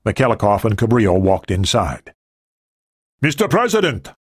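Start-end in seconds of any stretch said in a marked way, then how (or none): 3.2-4.1 raised, higher voice calling into the house; a pitched-up call, not much louder; calling loudly. A narrator's reading. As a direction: announce him to the next room. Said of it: "Michalikov said loudly".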